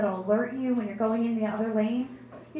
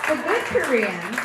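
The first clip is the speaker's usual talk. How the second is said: ironic aside; 6 dB louder, slower than usual. Acted. Tagline aside.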